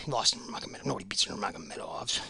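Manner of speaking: low whispered aside; hushed muttered whisper. Real, not acted. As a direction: whisper it under your breath. Muttered aside correcting himself.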